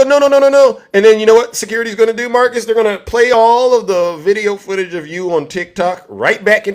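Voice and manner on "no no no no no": high, loud hammed-up pleading; mock panicked protest. Marcus protests his fate.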